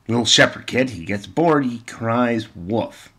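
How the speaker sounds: normal talking voice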